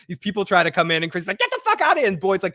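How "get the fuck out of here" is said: high-pitched mock angry yell; imitated yelling. Chrissy at people trying to come in, imitated high yell. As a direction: yell it as an acted order to get out. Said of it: "yelling at these people".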